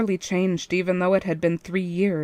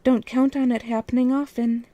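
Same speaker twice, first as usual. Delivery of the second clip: smirking whisper; 6 semitones higher than usual. Acted. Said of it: "I smirked and whispered to myself".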